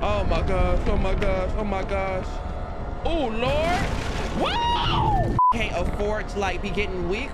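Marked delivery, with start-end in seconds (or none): 0.0-5.3 loud panicked exclamations; frantic, louder and louder; being chased. Real, not acted. Chased by enemies in game.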